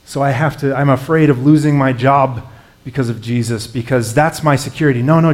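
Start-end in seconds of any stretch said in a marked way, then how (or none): none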